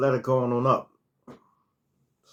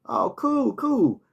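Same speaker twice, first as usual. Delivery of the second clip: a much higher put-on voice for the man; imitating him; 9 semitones higher, slower than usual. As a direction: lift the pitch way up, breezy. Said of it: "Now he said".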